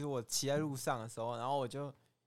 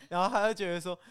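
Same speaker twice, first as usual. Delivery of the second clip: ordinary talk, nothing marked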